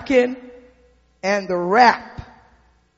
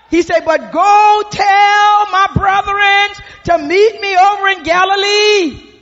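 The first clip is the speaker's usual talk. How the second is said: rising, louder preacher's cadence; preaching at full force; 7 semitones higher, 6 dB louder than usual. Acted. Preacher to the congregation, rising loud cadence. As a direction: build it up from the pulpit.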